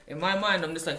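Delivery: ordinary talk, nothing marked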